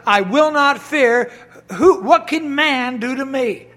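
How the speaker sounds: forceful sermon reading; preaching scripture. Acted. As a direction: proclaim each verse from the pulpit. Booming scripture quotations.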